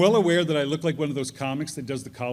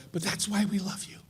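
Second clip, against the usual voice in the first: tender reassurance; 3 semitones higher, 8 dB quieter than usual. Retold or imitated. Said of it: "they would go".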